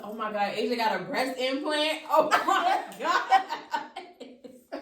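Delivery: laughing, building to a loud exclamation; mock shock. Teller replays her wild guess to family, laughing shock. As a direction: act appalled while breaking into laughter.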